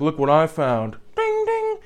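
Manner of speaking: lightly voiced dumb guy, the ding dings sung very high; a hint of a proud goofball with a toy bell. Imitating the dumb guy showing off a bell he found, lightly voiced. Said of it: "He's like".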